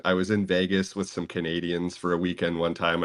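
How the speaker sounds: ordinary talk, nothing marked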